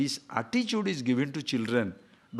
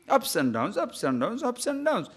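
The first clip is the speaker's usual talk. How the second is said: rising pitch; 6 semitones higher than usual. Shown as a demonstration. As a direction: climb up like a roller coaster. Mimicking mood swings.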